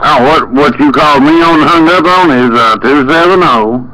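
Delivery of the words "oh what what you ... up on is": ordinary talk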